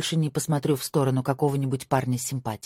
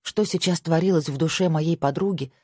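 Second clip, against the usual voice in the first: usual speaking voice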